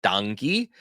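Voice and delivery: "Danke" said as DUNK-ee, ending in ee; "Danke" with an open ah, then kee; regional pronunciation. Shown as a demonstration. Teacher shows a third regional thank you, "Danke" as DUNK-ee. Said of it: "hear some people say".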